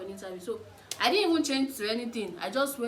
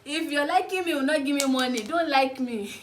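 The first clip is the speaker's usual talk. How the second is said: laughing; 5 semitones higher, 8 dB louder than usual. Real, not acted.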